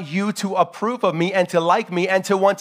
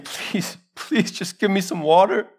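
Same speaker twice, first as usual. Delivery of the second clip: pleading; 3 semitones higher than usual. Acted. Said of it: "looking up to the man, uh, to Lazarus, and saying".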